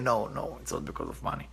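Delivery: near-whispered, drained denial; tearful denial. Retold or imitated. Crying student softly tells the prophet it isn't money. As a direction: breathe it out, barely voiced. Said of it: "see him crying".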